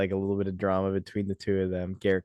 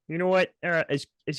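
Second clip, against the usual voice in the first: Kermit-like voice; 8 semitones higher, faster than usual. Retold or imitated. Imitating Gerrit Cole's Kermit voice.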